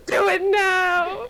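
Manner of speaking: slow, high, teasing command; mock dom order. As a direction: lightly play the dominant.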